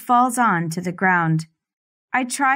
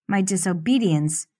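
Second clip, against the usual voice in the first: ordinary delivery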